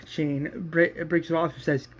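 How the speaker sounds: ordinary talk, nothing marked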